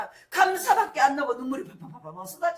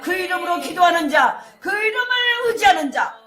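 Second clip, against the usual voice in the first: higher and louder proclamation; rising preacher's cry; 6 semitones higher, 11 dB louder than usual. Acted. Preacher to congregation, loud rising proclamation. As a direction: build the call to a peak.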